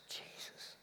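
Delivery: awed whisper; whispered, as if just recognizing someone; hushed recognition. Retold or imitated. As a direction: drop to a barely voiced whisper. Acting the moment of recognition.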